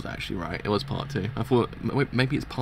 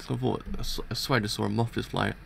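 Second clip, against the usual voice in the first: ordinary delivery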